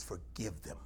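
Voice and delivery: low, hushed and distant; quiet voice of Jesus; soft command. Retold or imitated. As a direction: drop the voice to a gentle order. Jesus quoted softly.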